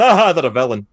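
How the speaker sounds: mock villain reveal. Mocking a film's sudden villain turn, loud mock reveal. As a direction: burst out with a hammed-up reveal.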